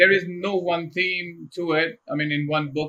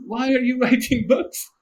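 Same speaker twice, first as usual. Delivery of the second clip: laughing; 10 semitones higher than usual. Retold or imitated. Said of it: "He said".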